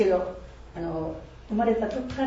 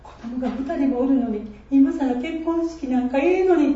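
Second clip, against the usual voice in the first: retold inner grumble; 6 semitones higher, 4 dB louder than usual. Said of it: "心の中でいつも思っとった".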